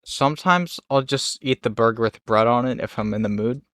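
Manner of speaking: usual speaking voice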